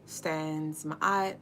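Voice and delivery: slow soft meditation voice; guided meditation. Acted. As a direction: guide them gently into calm. Leads a meditation.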